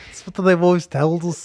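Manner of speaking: put-on slurred Scottish junkie accent; twitchy Scottish addict mumbling; twitchy slurred sniffing addict. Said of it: "Scottish junkie voice".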